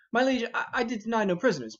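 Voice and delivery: high and fast, tumbling out the denial; flustered protest. Acted. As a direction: rush the words out to clear your name.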